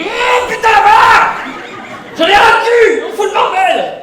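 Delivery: high, screaming rage at the puzzle; hammed-up furious scream; exasperated shout. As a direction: blow your top and scream.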